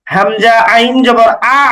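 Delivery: final আ sustained from the middle of the throat; mid-throat ain. Shown as a demonstration. Teacher drilling the letter ain, আ held in the throat. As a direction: slowly spell হামজা আইন জবর, then squeeze a continuing আ mid-throat. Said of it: "আইন মানে হচ্ছে আ কিন্তু গলার মাঝখানে".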